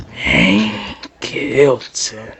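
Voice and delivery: villain chuckling as he names his victim; menacing villain. Acted. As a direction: savour the name like a killer finding his prey. Villain greets him slowly.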